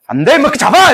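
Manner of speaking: 높게 치솟는 다급한 명령; 놓치지 않으려 소리치기. Acted. 대소가 추격 명령. (도망간 주몽을 쫓으라 명령하는 대소처럼, 높게 외침)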